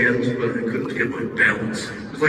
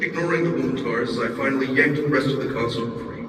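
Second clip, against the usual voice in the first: plain reading voice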